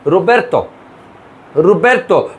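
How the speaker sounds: রোবের্তো with a rolled Italian r; Italian-style রোবের্তো; Italian pronunciation. Shown as a demonstration. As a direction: roll the r in রোবের্তো. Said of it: "কত সোজা, দেখছেন".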